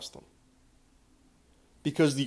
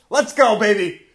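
loud, high hype yell; fired-up shout; 7 semitones higher, 7 dB louder than usual. Real, not acted. Podcaster firing himself up, loud excited yell. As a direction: pump yourself up with a yell. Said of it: "getting a little fired up".

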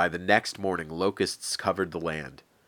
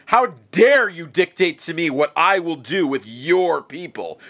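much higher and louder furious tirade; enraged shout; 8 semitones higher, 6 dB louder than usual. Acted. Enraged Pharaoh shouting threats of death at Moses. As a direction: roar it like a god-king defied. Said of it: "enraged, shouted back".

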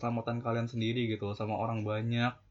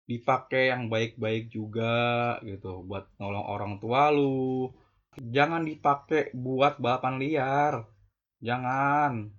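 slow stern advice; slower than usual. Real, not acted. (nasihat pelan dan tegas)

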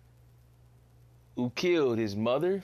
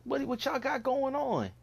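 exasperated retold rant; 8 semitones higher than usual. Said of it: "It's like".

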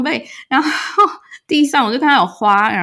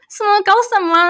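higher, retold furious outburst; angry disbelief; 12 semitones higher than usual. Retelling her angry reaction to the boyfriend's excuse, higher. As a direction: throw up your hands in anger. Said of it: "我很气".

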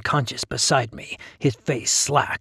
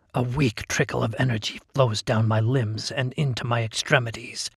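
ordinary delivery

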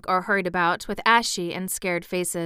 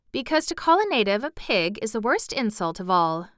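ordinary delivery

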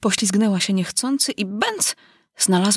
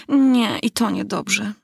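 usual speaking voice

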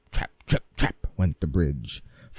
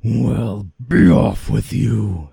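gruff troll; 5 semitones higher, 9 dB louder than usual. Acted. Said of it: "said the troll".

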